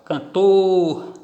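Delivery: Cantor with TOR held long and strong; stress on last syllable. Shown as a demonstration. Teacher demonstrating final-syllable stress, Cantor with a drawn-out TOR. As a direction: punch and stretch TOR in Cantor. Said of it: "Tem a tonicidade na última sílaba".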